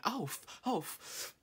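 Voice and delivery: high, breathless cut-off gasps; stunned gasp. Real, not acted. Fan pulling her bias card, gasping in shock. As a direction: choke on the words in shock.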